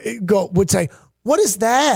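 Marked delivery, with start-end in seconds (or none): 1.2-2.0 imitating coy coworkers, high-pitched; high, angling mock wonder; feigned curiosity. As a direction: play innocent about someone else's food. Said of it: "in marketing and sales would".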